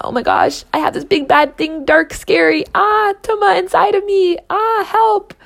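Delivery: high, loud hammed-up fright; mock panic. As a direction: squeal it like a cartoon scaredy-cat. Mocking panic about inner darkness.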